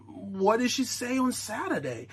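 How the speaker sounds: quietly anxious husband; mock hushed question. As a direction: ask it under your breath.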